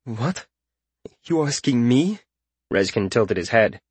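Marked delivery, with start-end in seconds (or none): 0.1-2.2 a small, taken-aback query; startled. A narrator's reading. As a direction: ask it as if caught off guard. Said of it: "Startled, Wesson said".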